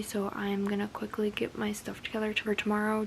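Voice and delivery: soft, hushed late-night update. Real, not acted. Vlogger telling viewers her bedtime plans, hushed.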